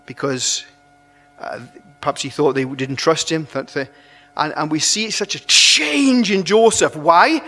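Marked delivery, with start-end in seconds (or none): none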